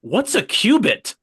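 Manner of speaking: high, drawn-out exaggerated confusion; mock bafflement. Bible reader baffled by Goliath's height, mock bafflement. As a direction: puzzle over it loudly, stretching each word.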